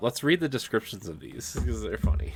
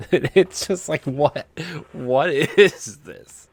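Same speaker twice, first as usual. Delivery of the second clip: louder and higher, the last words dragged out slow; baffled disbelief, stretch the final question; incredulous; 6 semitones higher, 8 dB louder, much slower than usual. Real, not acted. Reacting to the Clippy erotica title, loud and higher disbelief. Laughing at an absurd book title.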